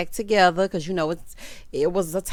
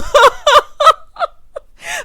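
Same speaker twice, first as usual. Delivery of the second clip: high laugh; 14 dB louder than usual. Real, not acted. Laughing at Baby Toya packing.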